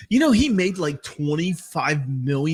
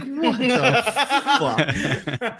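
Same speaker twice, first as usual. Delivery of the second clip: laughing disbelief; 7 semitones higher, slower than usual. Retold or imitated. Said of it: "And I'm like".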